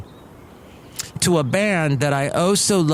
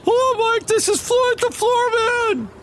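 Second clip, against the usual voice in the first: character voice. Pretend. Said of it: "Look who else is here".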